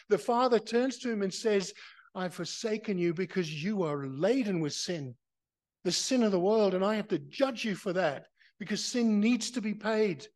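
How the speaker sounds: loud, high, fervent preaching; preaching at full force. Acted. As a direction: thunder it out from the pulpit.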